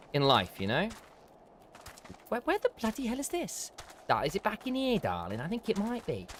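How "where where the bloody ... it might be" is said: put-on lightly done Essex accent, softer; a casual Essex local, quiet and chatty. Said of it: "Gone for an Essex accent now".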